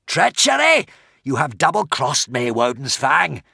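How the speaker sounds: furious accusing roar that settles; dragon's rage after the scream; raging dragon. A narrator's reading.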